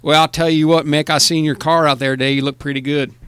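demonstrating a country Southern accent. Shown as a demonstration. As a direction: talk like a Southern NASCAR fan. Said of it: "Maybe from the South, possibly".

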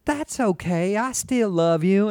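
high, drawn-out Southern mother imitation; bad Southern accent. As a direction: reassure in a put-on Southern twang. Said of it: "the worst Southern accent".

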